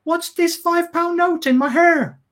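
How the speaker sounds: high, slow theatrical line; acting-class girl. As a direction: ham it up like a drama student, slowly. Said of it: "She goes".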